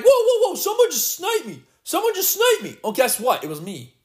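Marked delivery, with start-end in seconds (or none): 0.0-2.8 high, startled imitation of a sniped player; hammed-up panic of someone who just got sniped; mock panicked opponent. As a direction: mimic a rattled victim yelling whoa. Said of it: "You'll be like".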